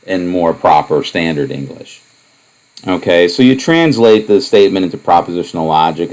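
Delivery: normal talking voice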